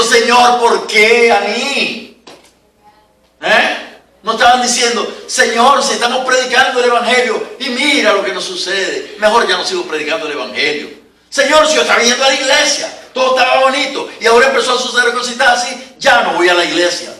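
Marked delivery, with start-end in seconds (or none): 0.0-2.2 mock whining complaint. Mocking complaining believers. 5.3-17.1 mock whining complaint. Mocking complaining churchgoer.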